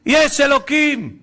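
high, loud, slow outcry; shouting. Retold or imitated. Survivor telling his mother what happened, shouting. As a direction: shout each phrase slowly to your mother. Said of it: "הוא צעק לי".

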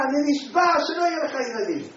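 loud, high furious vow; angry oath. Retold or imitated. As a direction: swear it at him in anger. Angry rabbi swears an oath. (שבועה בכעס וברוב קול)

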